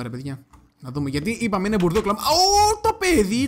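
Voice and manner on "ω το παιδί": high, loud cry of surprise; startled yell. Real, not acted. Player spots a hidden mob, startled yell. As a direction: jump out of your skin, voice leaping up.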